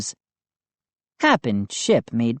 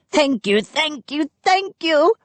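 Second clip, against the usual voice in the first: higher and higher squeaky thanks; squealing; 13 semitones higher than usual. A narrator's reading. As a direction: squeal it, climbing each time. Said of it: "Hap squealed".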